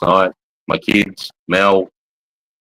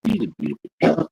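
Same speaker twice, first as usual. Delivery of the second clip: high, softer cooing; 6 semitones higher, 5 dB quieter than usual. Real, not acted. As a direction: coo at the puppy. Baby talk to the dog.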